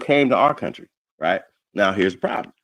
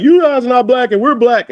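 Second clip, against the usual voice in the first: high, loud mocking voice; mock argument; 9 dB louder than usual. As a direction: mimic them yelling their claim.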